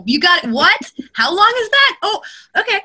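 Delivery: high-pitched baffled self-interruption; mock bewilderment. Narrator caught out by an endless sentence, baffled. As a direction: leap up in pitch, comically thrown.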